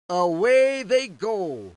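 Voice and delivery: usual speaking voice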